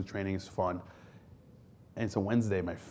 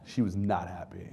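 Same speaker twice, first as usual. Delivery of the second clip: ordinary talk, nothing marked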